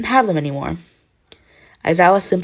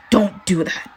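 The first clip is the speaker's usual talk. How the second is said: snapped; 3 semitones higher, slower than usual. A narrator's reading. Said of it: "Katsuki snapped".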